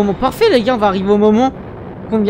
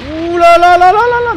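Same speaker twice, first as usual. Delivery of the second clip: amazed; 9 semitones higher, 5 dB louder than usual. Real, not acted. Streamer amazed by scene.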